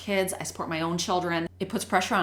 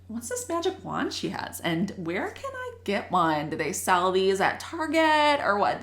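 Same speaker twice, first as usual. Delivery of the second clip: naive new teacher; 2 semitones higher than usual. Retold or imitated. New teacher wondering about her colleague's magic wand, naive and puzzled. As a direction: wonder it aloud like a baffled newcomer. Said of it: "So I was like".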